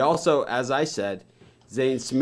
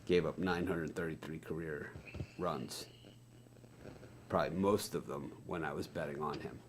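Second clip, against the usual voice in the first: much softer and lower, half to himself; muttered aside; 7 semitones lower, 15 dB quieter than usual. Real, not acted. Host reading a pitcher's stats to himself, muttered aside. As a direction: mutter it low, trailing away.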